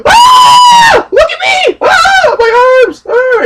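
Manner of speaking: very high, loud hammed-up screams of horror; mock screaming. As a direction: shriek like your body is stretching. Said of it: "he just screams".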